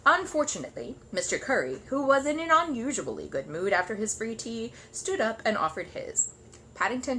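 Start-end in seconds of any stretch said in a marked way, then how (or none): none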